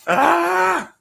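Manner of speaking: sudden high yelp of fright; startled shriek. Real, not acted. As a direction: shriek as the bird darts at you. Bird flying loose in room.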